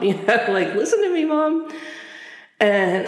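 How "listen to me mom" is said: high-pitched whine for attention; insistent, high-pitched mock plea; pleading kid. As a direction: imitate a kid demanding Mom listen. Acting out childhood begging of mother.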